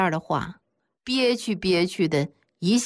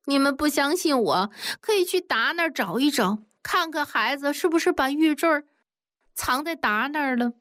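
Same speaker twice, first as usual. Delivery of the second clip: higher, hurt and wronged; aggrieved; 8 semitones higher than usual. A narrator's reading. 小凤 to disbelieving men, mildly aggrieved. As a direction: plead to be believed, mildly hurt. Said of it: "马上就委屈地说".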